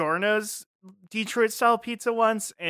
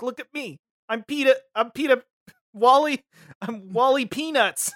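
higher, playful put-on voice; mobster as Charlie Brown; 5 semitones higher than usual. Paulie Walnuts dressed up as Charlie Brown, hammed-up role. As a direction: announce yourself in a mock character voice. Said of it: "He's like".

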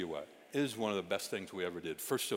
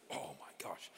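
soft whisper; 5 semitones lower, 10 dB quieter than usual. Real, not acted. Moved by small boy.

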